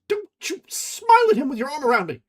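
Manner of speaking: high, loud hateful cry; bitter outburst. A narrator's reading. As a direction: spit it at him. Ferguson snapping at Catherine.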